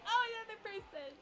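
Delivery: high, loud, excited dog voice; voicing the dog. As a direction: speak as an eager dog spotting company. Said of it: "He's like".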